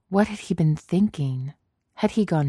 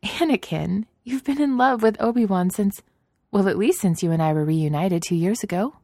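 amused Padme; 5 semitones higher than usual. Acted.

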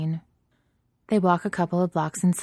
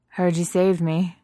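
raspy voice; 6 dB louder, slower than usual. A narrator's reading. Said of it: "her voice raspy".

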